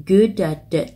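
quick American good at it; flapped t. Shown as a demonstration. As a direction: link and flap the t. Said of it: "âm t này nó bị flap đi".

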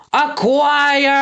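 very high, drawn-out battle cry; bellowed. A narrator's reading. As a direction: bellow one long word. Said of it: "Gristle bellowed".

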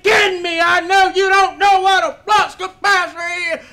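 loud, high, outraged yelling; outraged shout. Acted. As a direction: blow up at the customer in disbelief. Mechanic explodes at customer.

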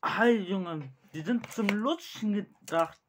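high, loud outburst; shouting. Real, not acted. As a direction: yell it out in shock. Shouting about sour candies.

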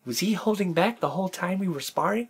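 lightly mimicked martial arts YouTuber; slightly put-on presenter voice, asking the camera; a hint of a YouTuber impression; Jesse Enkamp. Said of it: "That's Jesse".

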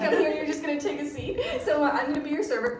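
high, fast, giggly server patter; perky server. Retold or imitated. Bubbly American waitress seating guests, high and bright. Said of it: "It's like".

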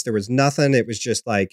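ordinary delivery

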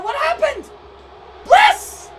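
very high, loud shocked yell; stunned outcry. Real, not acted. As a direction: scream the name in disbelief. Commentator stunned by elimination.

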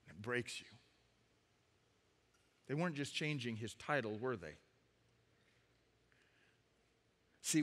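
low, soft, grave reflection; hushed, solemn. Real, not acted. Preacher explaining what the renaming took away, hushed. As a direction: let each point sink in quietly.

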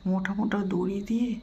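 normal talking voice

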